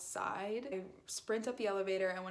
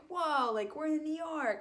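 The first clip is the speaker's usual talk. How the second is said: higher, louder mock vlogger hype; mock excited YouTuber guys opening a vlog; 8 semitones higher, 5 dB louder than usual. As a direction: ham up a dude-bro vlogger intro. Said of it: "And they were like".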